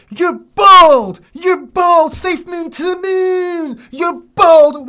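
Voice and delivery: high, loud mocking heckler voice; shrill-free loud mock taunt from the comment section; mocking commenters. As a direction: jeer it like a taunting troll. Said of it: "most of the comments are".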